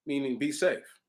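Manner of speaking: ordinary delivery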